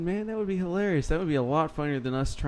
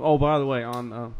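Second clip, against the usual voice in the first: ordinary talk, nothing marked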